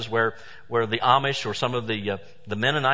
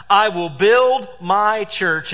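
slow, louder, every word weighed; quoting Jesus with slow grandeur; solemn proclamation; 6 semitones higher, 6 dB louder, much slower than usual. Acted. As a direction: proclaim it like scripture from the pulpit.

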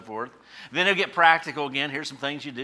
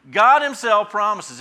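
emphatic preaching; 7 semitones higher, 10 dB louder, slower than usual. Acted.

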